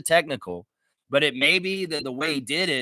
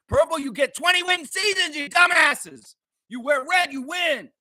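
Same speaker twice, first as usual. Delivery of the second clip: much higher, scornful shouting; angry taunt; 11 semitones higher than usual. Real, not acted. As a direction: jeer at the purple fans. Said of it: "This is pissing me off".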